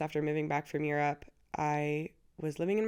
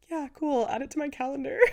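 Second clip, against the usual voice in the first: mock breezy; 9 semitones higher, 3 dB louder than usual. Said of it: "And I'm like".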